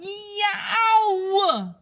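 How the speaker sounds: high, loud cry of tooth pain; loud, high yelping child in pain; pained yell. Acted. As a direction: wail for Mommy like a hurting kid. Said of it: "and yelled".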